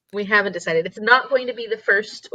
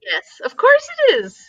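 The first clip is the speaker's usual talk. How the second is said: high, louder gushing; gushing affection; 6 dB louder than usual. Real, not acted. Streamers gushing love at a birthday viewer. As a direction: gush over her warmly.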